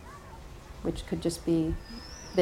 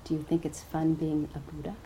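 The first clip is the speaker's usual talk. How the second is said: softer, lower and calm; quiet voice; 3 semitones lower, 8 dB quieter than usual. A narrator's reading. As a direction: confide it quietly to Mara. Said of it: "he said in a quiet voice".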